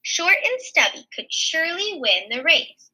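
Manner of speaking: usual speaking voice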